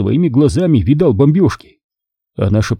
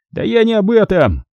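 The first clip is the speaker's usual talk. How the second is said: growled; 11 semitones higher than usual. A narrator's reading. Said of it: "прорычал Гальс".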